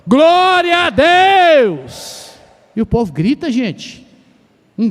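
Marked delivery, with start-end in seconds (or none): 0.0-2.4 much higher and louder, stretched very slowly; second shout, all-out; full-force praise shout. Shown as a demonstration. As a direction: give it everything this time, bring the walls down. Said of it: "Vamos lá de novo?".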